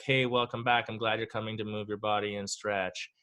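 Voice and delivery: mild, soothing welcome, softening at the end; calm yoga greeting. Acted. Yoga studio welcoming a returning member, calm and low-key. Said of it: "at the yoga studio".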